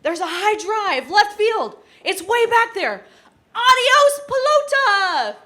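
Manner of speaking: booming, high play-by-play announcer; John Miller home run call. Pretend. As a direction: shout the homer call like a radio announcer. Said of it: "you're gonna do John Miller's call".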